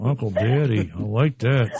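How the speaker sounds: through clenched teeth. Real, not acted. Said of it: "Stop talking through your teeth".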